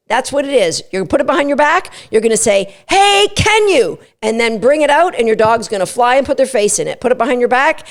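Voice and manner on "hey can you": high, slow, sing-song "Hey, can you?"; excitable cue. Shown as a demonstration. Trainer demoing the muzzle cue, excitable. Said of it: "say it in a really excitable way".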